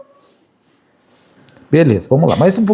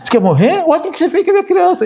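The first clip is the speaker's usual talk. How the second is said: mock threat, laughing; 8 semitones higher, faster than usual. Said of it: "eu levantei e falei assim".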